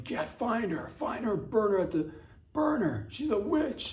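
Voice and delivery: rising to high shrieking cries to burn her; angry mob yelling. Retold or imitated. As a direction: whip up a frenzied witch-hunt crowd. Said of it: "people were like".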